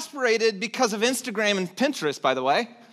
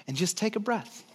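soft, calm breathing cue; calm invitation; 6 dB quieter than usual. Real, not acted. Pastor guiding moms to pause, soft and calm. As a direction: gently invite them to breathe. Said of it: "take a moment with me".

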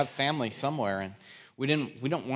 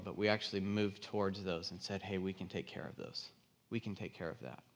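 soft, low, earnest resolve; hushed closing encouragement; quiet appeal; 3 semitones lower, 8 dB quieter than usual. Real, not acted. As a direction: drop the volume and mean it.

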